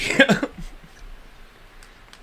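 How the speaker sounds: breathy high laugh; wheezing laugh. Real, not acted. Laugh after quote.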